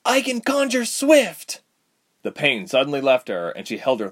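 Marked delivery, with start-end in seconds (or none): 0.1-1.6 desperate cry. Acted. Heroine tries to speak spell.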